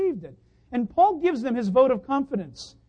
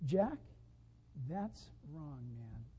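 hushed, low correction trailing softer; gentle rebuke; 8 semitones lower, 16 dB quieter, slower than usual. Acted. The Lord telling the preacher he is wrong, gently hushed. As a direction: let the reproof land very quietly. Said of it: "And the way he says it is".

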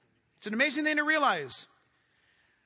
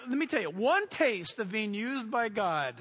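normal talking voice

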